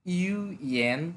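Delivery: "UN" slowly pulled apart into ইউ and এন; syllable by syllable. Shown as a demonstration. Teacher reviewing words for learners, "UN" slowly split in two. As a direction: separate the two halves of "UN", drawn out.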